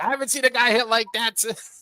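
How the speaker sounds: high-pitched mock baseball reminiscence; old-time baseball talk. Co-host joking about baseball in nineteen seventeen, mock old-timer.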